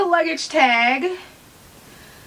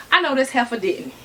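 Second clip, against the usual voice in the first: imitated friend's scolding. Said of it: "gonna be like".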